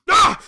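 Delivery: sudden sharp yelp of pain; loud pained cry. Acted. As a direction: cry out as the wound hurts. Man cries out in pain.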